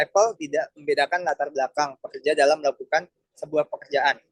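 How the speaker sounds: normal talking voice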